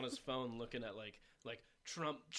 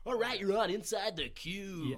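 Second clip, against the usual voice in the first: slow loud higher "Inside the Q" announced; radio host voice; 7 semitones higher, 7 dB louder, slower than usual. Retold or imitated. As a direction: say "Inside the Q" like a show intro. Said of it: "like a radio host who's just like".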